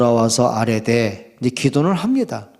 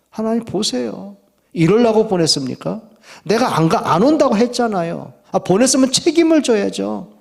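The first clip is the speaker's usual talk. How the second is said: acted grievance in a raised pitch; complaining to God; 7 semitones higher than usual. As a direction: throw the blame back in higher, insistent protest. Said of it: "이렇게 나오는 거예요". (하나님께 대들며 따지는 말투)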